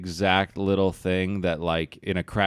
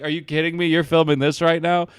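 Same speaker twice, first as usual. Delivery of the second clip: exasperated; 8 semitones higher, 6 dB louder than usual. Retold or imitated. Confronting the guys filming him.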